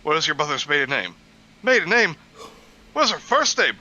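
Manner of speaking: high, loud, fast comic shouting; quoting a sitcom. Retold or imitated. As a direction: rant it like a frantic sitcom character. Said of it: "quoted one of my favorite lines".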